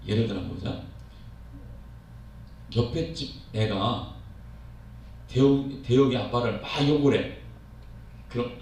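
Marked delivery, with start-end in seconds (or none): none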